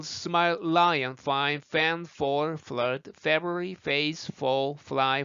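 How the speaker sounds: long word list chained on one breath. Shown as a demonstration. Teacher demonstrating the whole list to learners, in one breath. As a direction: run every word together without a gap. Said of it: "한 호흡에 가볼까요".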